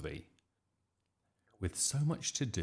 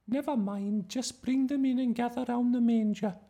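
very high, loud schoolmistress; teacher directing children; 8 dB louder than usual. A narrator's reading. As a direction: marshal the children in a high-pitched voice. Teacher directing the nativity rehearsal.

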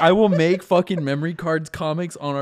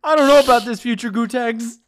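higher, laughing; doubting Malcolm; 6 semitones higher than usual. Acted. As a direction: doubt him through a laugh.

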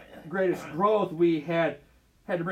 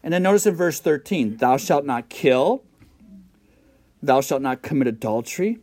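loud, fervent sermon voice, much louder; full-force preaching; 5 semitones lower, 9 dB louder than usual. Acted. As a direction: preach it out to the congregation. Pastor reciting commandments loudly.

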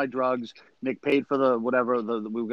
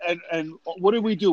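normal talking voice

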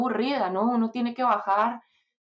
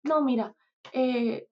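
higher-pitched imitation of the manager scolding; mock scolding; 5 semitones higher than usual. Manager scolding her to grow up, imitated higher. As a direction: play the manager telling her off, half in jest. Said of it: "y me regaña".

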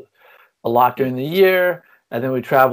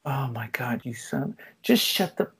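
exasperated mom; 9 dB quieter than usual. Retold or imitated. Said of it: "mom answering kid".

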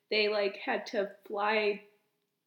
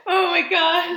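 startled laugh; 6 semitones higher, 11 dB louder than usual. Real, not acted. Startled by printer noise.

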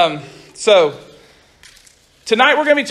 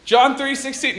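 higher, playful call to a bird; mock preaching to birds; 6 semitones higher than usual. Youth pastor jokingly preaching to birds, mock call-out. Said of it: "go out and be like".

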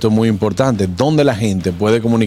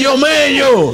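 retold call, much higher, the nickname sung out; 12 semitones higher, much slower than usual. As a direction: roep Mello hoog over naar je maat. Calling friend's nickname, retold.

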